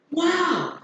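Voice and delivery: very high, amazed wow; surprised exclamation. Shown as a demonstration. Teacher summing up exclamation examples, surprised. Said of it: "Such kind of expressions".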